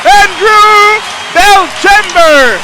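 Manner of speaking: loud, high, stretched-out goal shout; excited sports commentary. Real, not acted. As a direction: scream the goal call to the crowd. Goal call in play-by-play. (commentaire de but survolté)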